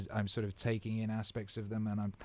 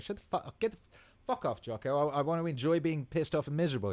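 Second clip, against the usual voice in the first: higher, whiny protest at the mentor; mock whiny grumbling; 7 semitones higher than usual. To Jocko, refusing his advice, in a high whiny complaint. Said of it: "You're like".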